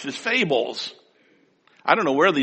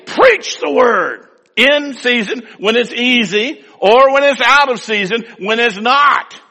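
higher, louder preaching; 8 semitones higher, 5 dB louder than usual. Acted. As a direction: proclaim the command. Preaching Paul's command.

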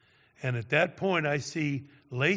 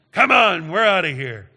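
loud, higher angry bark; wicked, mean; 3 semitones higher, 13 dB louder than usual. Retold or imitated. Boyfriend dragging Lacey off, loud mean bark. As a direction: yank her away with a mean shout. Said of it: "Just wicked, mean".

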